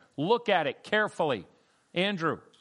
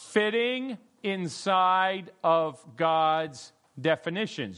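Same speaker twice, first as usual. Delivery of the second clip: slow, emphatic repeat; much slower than usual. Shown as a demonstration. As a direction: repeat the phrase slowly and clearly for all. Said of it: "Did you get that?".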